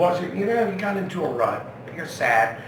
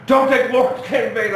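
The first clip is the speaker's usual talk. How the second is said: higher, louder scolding big sister; 8 semitones higher, 8 dB louder than usual. Retold or imitated. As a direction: snap at him to mind his tongue. Sister scolds him for swearing.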